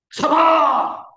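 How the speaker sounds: high loud shout of Sabar. Acted. Preacher acting a wife at the end of her patience, shouting. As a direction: yell Sabar. with a burst.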